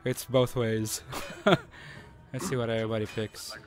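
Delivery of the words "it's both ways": normal talking voice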